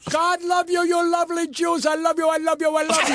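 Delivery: high, gushing declaration of love; effusive gratitude. Real, not acted. Effusive thanks to remembered benefactors.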